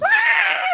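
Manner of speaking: very high, delighted fangirl squeal. Real, not acted. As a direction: squeal out at the cute moment. Squeal after forehead touch.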